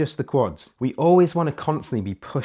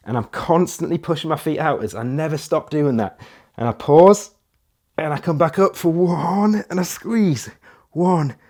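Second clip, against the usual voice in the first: louder and higher, driving every rep; pumped-up coaching; 4 semitones higher, 6 dB louder than usual. Acted. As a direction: push the class through the burn. Coach demonstrating, pushing hard.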